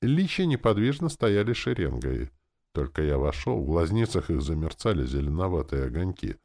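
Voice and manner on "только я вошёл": ordinary delivery, nothing marked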